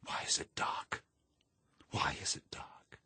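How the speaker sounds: hushed, whispered inner scream; voiceless, desperate whisper; screaming in thought. A narrator's reading. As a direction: whisper it like a scream held inside. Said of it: "and then thought screamingly".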